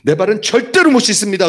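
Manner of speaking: high, loud protest; forceful refusal. Retold or imitated. Peter refusing to let Jesus wash him, forceful protest. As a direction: refuse outright, punching 절대로 high and loud.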